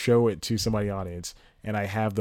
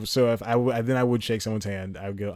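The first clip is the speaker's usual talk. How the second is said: normal talking voice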